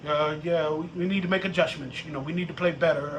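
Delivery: low, fast, mumbled lines; canned player speak. Retold or imitated. Mocking the lineman's press answer, low fast mumble. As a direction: rattle off the rote answer flatly. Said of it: "he speaks in tongues".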